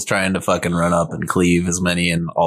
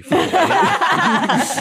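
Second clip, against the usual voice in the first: laughing; much slower than usual. Real, not acted. Teasing insult, laughing.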